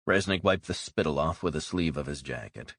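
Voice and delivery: ordinary talk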